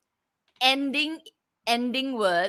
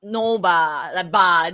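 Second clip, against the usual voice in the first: "No ba" with a Singaporean ending, a bit lower; 3 semitones lower, 5 dB louder, much slower than usual. Shown as a demonstration. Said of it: "let's say".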